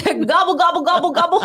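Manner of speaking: high gobbling turkey voice; turkey impression. Shown as a demonstration. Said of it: "That's my turkey impression".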